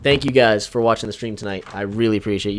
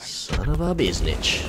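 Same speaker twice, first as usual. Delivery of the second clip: mock curse; 3 semitones higher, slower than usual. Real, not acted. Mock curse during game.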